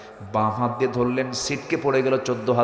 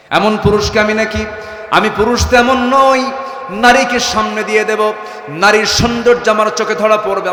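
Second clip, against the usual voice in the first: loud, high preacher's indignation; indignant full-force retort; acted outrage; 10 semitones higher, 11 dB louder than usual. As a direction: thunder it out as an offended man. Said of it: "হঠাৎ করে খেপে বলছে".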